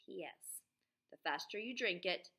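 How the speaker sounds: teacher reading a recipe list to her class; reading to children. Acted. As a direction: announce each ingredient to the kids. Reads the recipe aloud to class.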